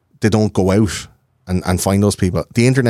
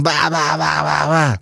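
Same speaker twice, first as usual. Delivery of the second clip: put-on drunk babble; 7 semitones higher, slower than usual. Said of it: "you were just going".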